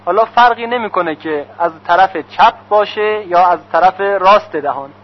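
ordinary talk, nothing marked

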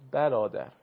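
برادر with short fatha, lips spread flat; vowel demo. Shown as a demonstration.

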